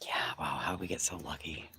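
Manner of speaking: mock the luck under your breath; sarcastic whisper. Real, not acted. Sarcastic aside about low numbers.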